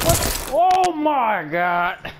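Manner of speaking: slow, stretched high oh my god; shocked cry. Real, not acted.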